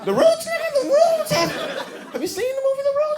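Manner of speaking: high, loud gleeful yell; real excited yell; excited shout. Real, not acted. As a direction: shout the punchline with delight.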